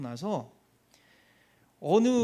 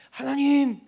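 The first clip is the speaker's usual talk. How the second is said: prayer call; 7 semitones higher, 7 dB louder, slower than usual. Retold or imitated. Retells own prayer.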